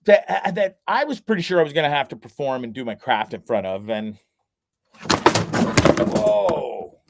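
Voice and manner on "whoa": startled whoa. Real, not acted. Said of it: "scared the shit out of me".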